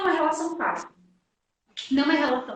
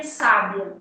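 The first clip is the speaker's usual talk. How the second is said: sábia stressed on SÁ, falling low; stress on first syllable; 7 semitones lower than usual. Shown as a demonstration. Teacher contrasting three look-alike words, sábia stressed on sá. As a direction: hit the sá of sábia, then drop. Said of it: "É a marcação de sílaba tônica".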